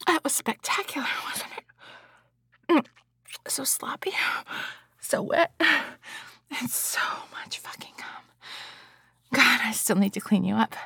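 hushed, teasing and tender. Acted. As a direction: murmur it soothingly afterward. Post-climax aftercare roleplay.

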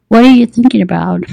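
quick, lightly teasing question with a hint of British; teasing British accent. A narrator's reading. Man to his date over menus, teasing British accent. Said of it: "his tone teasing and his British accent very noticeable".

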